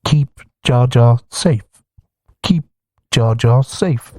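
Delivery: slow flat robot voice; monotone robot chant. Acted. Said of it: "repeating the words in a robot voice".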